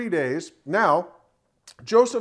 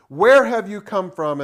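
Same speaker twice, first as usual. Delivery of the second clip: loud, slow, harsh demand; 3 semitones higher, 8 dB louder, slower than usual. A narrator's reading. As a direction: read it as a stern, drawn-out challenge. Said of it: "he spoke to them harshly".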